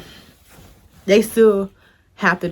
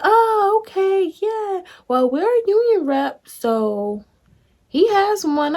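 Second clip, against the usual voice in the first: lightly voiced lady, higher; chirpy high mock lady reply; imitating the lady; 12 semitones higher, 3 dB louder, slower than usual. Mimicking the union lady answering her, higher.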